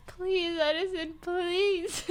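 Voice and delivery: high, whiny mock plea; pleading. Caretaker to a drunk who won't stand straight, pleading.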